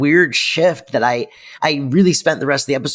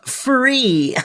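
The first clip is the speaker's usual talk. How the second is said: punchline; 11 semitones higher than usual. Retold or imitated. Punchline of mother's quote.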